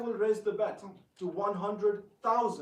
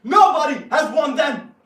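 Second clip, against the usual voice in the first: high, very loud retort; furious high shout; 8 semitones higher, 19 dB louder than usual. Acted. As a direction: blast it back. Furious rebuttal.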